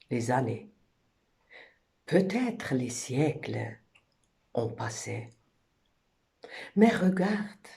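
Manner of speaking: measured, dramatic poetry reading with long pauses between lines; poem recitation. Acted. Poet reciting own French poem to listeners, solemn recitation. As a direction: recite in swelling phrases that sink into hushed endings.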